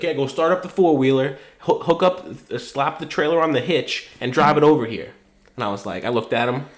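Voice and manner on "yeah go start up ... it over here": casual dad orders, a bit higher and louder; rattling off chores like his dad; dad's instructions. Retold or imitated. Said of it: "he was like".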